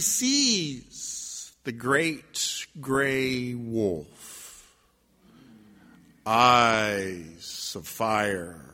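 very slow, low storytelling; ominous narration. A narrator's reading. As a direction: draw it out with long, suspenseful pauses. Suspenseful entrance of the wolf.

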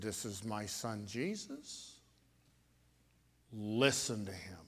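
quiet, lightly voiced voice of God; a hint of a solemn heavenly voice; God's voice. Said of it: "God's voice said".

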